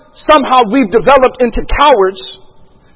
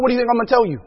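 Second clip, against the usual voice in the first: ordinary talk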